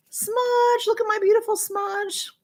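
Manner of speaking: very high, sing-song pet voice; doting coo. Real, not acted. Showing off her beloved pet's picture.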